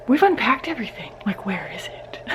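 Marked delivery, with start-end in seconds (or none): 1.2-2.2 hushed, breathy whisper of frustration. Real, not acted. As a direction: whisper it under your breath. Searching, puzzled aside to viewers.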